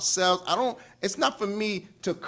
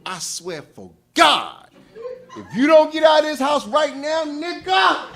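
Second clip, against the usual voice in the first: building into a hammed-up furious yell; mock rage, loud and high; mock angry threat; 8 semitones higher, 8 dB louder than usual. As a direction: act out a man losing his temper, laughing through it. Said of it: "But if it's like".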